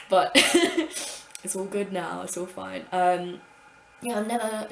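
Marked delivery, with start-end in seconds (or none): 0.4-1.4 high breathy laugh; breathy laugh at the memory; airy laugh. Real, not acted.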